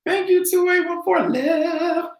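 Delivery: high, slow mock radio voice; clipped radio-style readback; mock radio call. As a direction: play a pilot calling the tower.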